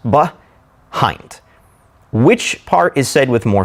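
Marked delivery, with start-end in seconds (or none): 0.1-1.4 behind broken in two, be, then hind, with a pause between; split syllables. Shown as a demonstration. Teacher showing the two syllables, "behind" pulled apart. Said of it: "Behind has two syllables".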